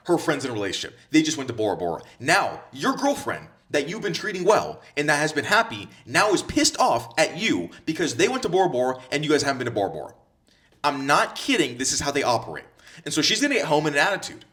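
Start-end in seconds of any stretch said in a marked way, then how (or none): none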